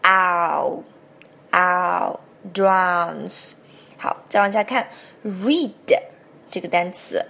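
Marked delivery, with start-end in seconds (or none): 0.0-3.5 "ow" drawn out very slowly; slow model "drowns" with a full "ow"; stretched middle vowel. Shown as a demonstration. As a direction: hold the "ow", then place it inside "drowns". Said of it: "注意中间的元音".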